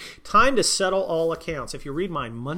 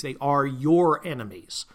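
ordinary delivery

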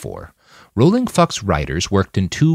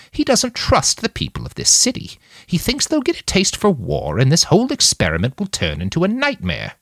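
high-pitched voice, a bit tipsy; slightly drunken slur; 11 semitones higher than usual. A narrator's reading. Nanayazi explains Donald's distrust of the city, tipsy slur. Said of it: "with a bit of drunken slur to her voice".

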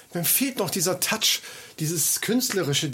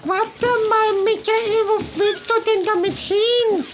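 high squeaky voice; 4 dB louder, slower than usual. Acted. Calling out in game.

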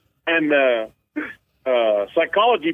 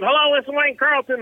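higher-pitched mock phone pickup; imitated phone greeting; 10 semitones higher than usual. Elk caller answering dad's phone call, imitated high-pitched greeting.

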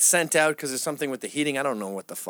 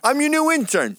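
eager intern; 5 dB louder than usual. Pretend. Playful joke to friend.

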